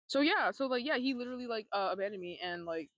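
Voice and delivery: normal talking voice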